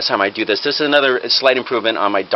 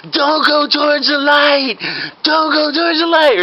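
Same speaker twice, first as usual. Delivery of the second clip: very high, drawn-out mock desperation; melodramatic plea; 13 semitones higher, slower than usual. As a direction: call a dying man back, overacting. Fake doctor shaking dying patient.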